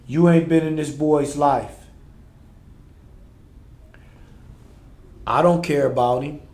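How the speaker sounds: loud, high repeated accusations; escalating role-play shouting. Acted. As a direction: build the fight in the reenactment.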